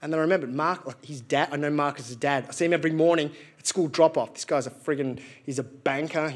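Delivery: normal talking voice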